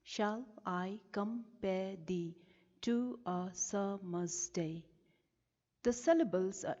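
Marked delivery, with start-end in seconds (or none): 0.0-4.8 slow, "Shall I com-pare thee to a sum-mer's day" split into ten beats; syllable by syllable. Shown as a demonstration. Teacher showing the line has ten syllables, slow syllable-by-syllable reading. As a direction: count out each syllable of "Shall I compare thee to a summer's day" slowly and separately. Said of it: "This line has ten syllables".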